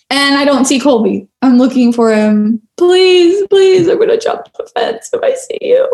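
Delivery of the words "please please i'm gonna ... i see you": crying plea. Retold or imitated. Exhausted runner begging husband to stay away, crying. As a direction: beg through tears, voice cracking high.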